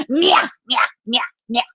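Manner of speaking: short meows, then one long one; menacing cat meows. Acted. Cat looks down at los ratones from the fence, meowing. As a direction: count out small meows and stretch the last. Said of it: "it says".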